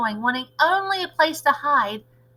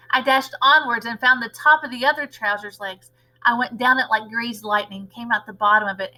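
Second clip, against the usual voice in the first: usual speaking voice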